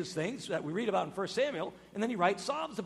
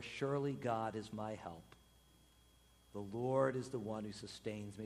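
solemn psalm reading; 10 semitones lower, 8 dB quieter than usual. Acted. Rereading the psalm verse.

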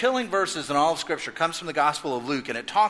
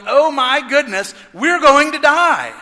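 high, loud mock panic; frightened disciples shouting over the storm; panicked cry; 10 semitones higher, 13 dB louder than usual. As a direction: cry out as if about to drown.

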